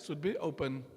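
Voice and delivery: ordinary delivery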